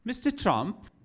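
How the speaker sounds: plummy British official, politely patronizing; British accent. Retold or imitated. British accent, comic quizzing Trump at an embassy. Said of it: "I'm doing a British accent".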